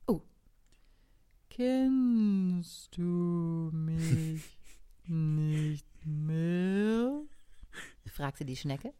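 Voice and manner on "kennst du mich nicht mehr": slow snail. Acted. Snail speaks slowly to Pinocchio.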